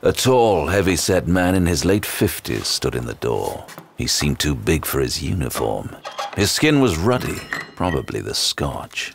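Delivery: dry first-person narration of a detective story. Acted. Sinclair narrates the constable's arrival, dry detective narration. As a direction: describe the man like a weary detective.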